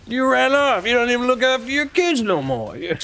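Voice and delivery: high, loud cowboy protest; indignant outlaw. Acted. Outlaw quarrelling about kids running off, indignant. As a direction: argue back in a put-on, high outlaw voice.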